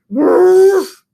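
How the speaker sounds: loud, high gruff bark; a big hammed-up ruff; hungry bear ruff.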